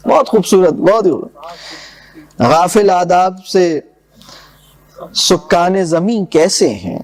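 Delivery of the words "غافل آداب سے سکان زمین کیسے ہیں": measured, lower verse recital; poem recitation. Acted. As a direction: recite the couplets with gravity. (شاعرانہ انداز میں پڑھت)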